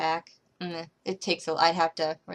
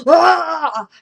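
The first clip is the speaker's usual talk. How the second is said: a loud, much higher "Ah!" as he lunges; mock angry yell; 9 semitones higher, 12 dB louder than usual. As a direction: shout it like a man charging with a knife. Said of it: "this guy's like".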